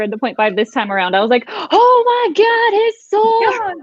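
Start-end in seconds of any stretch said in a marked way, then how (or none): 1.5-3.8 high, slow, drawn-out squeal of recognition; excited realization. Retold or imitated. Retelling her reading reaction to friends, high excited squeal. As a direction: gasp it out like a fan spotting the clue. Excited fan gasps at sword reveal.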